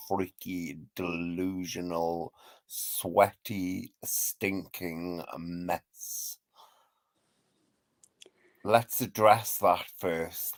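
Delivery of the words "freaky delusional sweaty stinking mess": slow soft insults. Real, not acted.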